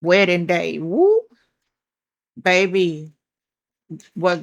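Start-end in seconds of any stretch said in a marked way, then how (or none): 0.8-1.2 sudden high whoop; surprised whoop. Real, not acted. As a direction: yelp in surprise. Sudden exclamation on card.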